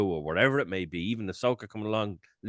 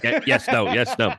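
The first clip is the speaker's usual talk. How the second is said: playful, slightly louder yes-no impression; Grogu; 5 dB louder than usual. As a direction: lightly mimic the character's stock replies. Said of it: "Love your Grogu impression".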